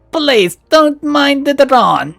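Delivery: smooth it over like a polite Russian host; Russian-accented owner apologising for his territorial drone; apologetic Russian accent. Acted. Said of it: "his tone goes apologetic".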